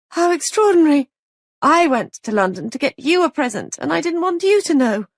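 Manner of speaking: high overwrought female character voice; Molly, distraught. Acted. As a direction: play a frantic young wife pouring it out.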